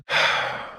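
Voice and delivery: long breathy sigh. Acted. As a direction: let out a long, wordless exhale. Wistful after mentioning his kid.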